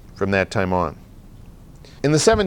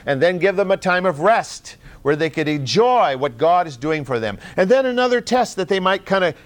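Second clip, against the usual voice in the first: ordinary delivery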